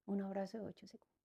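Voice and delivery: unvoiced whisper, a bit fast. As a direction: let it out as a secret hint. El doctor propone el abrazo.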